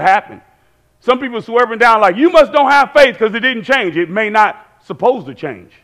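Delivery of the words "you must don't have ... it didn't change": mock accusation, higher and louder; accusing. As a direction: imitate a scolding believer, pitched up. Mimics judgmental people.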